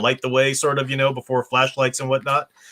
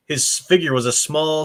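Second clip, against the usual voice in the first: ordinary talk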